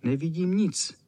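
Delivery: slightly high little squeak; squeaky. Acted. The hidden flea complains of seeing nothing, squeaking. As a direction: pipe it up lightly. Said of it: "pištělo to".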